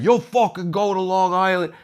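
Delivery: high, heated demand at the cabbie; angry yelling. Retold or imitated. Friend yelling at the cab driver, angry and high. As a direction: shout down the driver. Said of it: "yelling and fighting".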